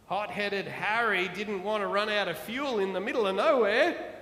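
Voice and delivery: plain reading voice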